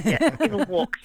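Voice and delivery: band name in an Australian accent. Shown as a demonstration. Singer shows host the band name at home, Australian accent. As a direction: say it with native Australian vowels. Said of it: "in my accent".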